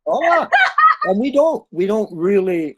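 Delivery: high, loud laughing protest. Real, not acted.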